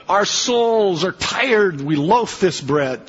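slow, weary, put-upon moan with a laugh; mock complaining Israelites. Preacher mimicking the Israelites fed up with manna, mock weary whine. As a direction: ham up the tired grumble.

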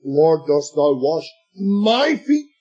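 pause, then MY drawn out and rising into feet; stress on MY. Shown as a demonstration. Preacher trying the emphasis on each word in turn, MY FEET stressed. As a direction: hold back, then swoop up slowly on my feet. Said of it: "emphasis could be put severally on each word".

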